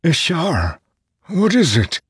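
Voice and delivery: high-pitched anxious question; alarmed. Acted. Nale to the herald staring at the sky, alarmed. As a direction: ask in rising alarm.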